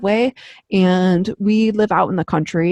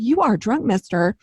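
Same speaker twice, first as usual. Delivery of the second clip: scolding parent; 4 semitones higher than usual. Retold or imitated. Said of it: "feel like I'm a parent".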